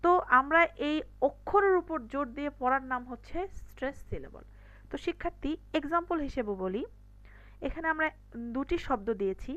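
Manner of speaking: usual speaking voice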